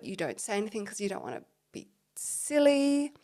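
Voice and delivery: usual speaking voice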